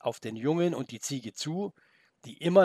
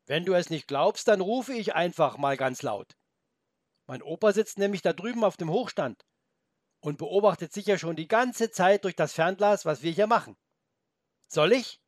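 put-on higher, challenging boy; boy's voice; 5 semitones higher, 4 dB louder than usual. The boy dares the wolf, high and challenging. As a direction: dare him like a cheeky boy.

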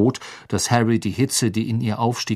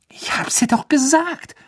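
higher, urgent hissed reproach; hissing Ron. Acted. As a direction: hiss it at her like an anxious friend. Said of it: "zischte Ron".

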